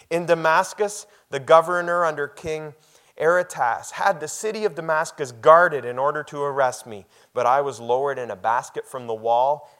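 loud, high, ringing scripture reading; preaching at full force. Acted. Pastor to congregation, reading Paul's boast, loud and ringing. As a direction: proclaim each question like a challenge. Reads Paul's escape story.

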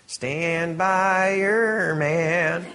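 slow, drawn-out Southern country twang. Shown as a demonstration. Pastor jokingly singing a country line, hammed-up twang. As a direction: croon it like a hammed-up country singer. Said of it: "That was my worst twang ever".

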